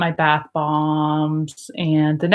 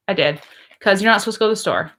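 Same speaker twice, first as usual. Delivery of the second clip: ordinary talk, nothing marked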